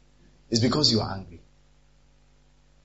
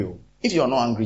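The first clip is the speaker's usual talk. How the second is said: ordinary talk, nothing marked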